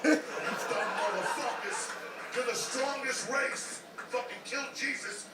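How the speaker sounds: slow, high and loud, laughing at them; laughing rant. Real, not acted. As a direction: hammer them slowly while laughing. Laughing rant about converts.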